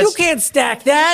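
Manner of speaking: high, drawn-out mock shout; mock game-night yell. Host joining in the acted Uno fight, mock yell. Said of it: "when I yell".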